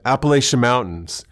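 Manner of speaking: imitating words that burst out unbidden; sudden blurt. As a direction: blurt it out of nowhere. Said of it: "like Tourette's".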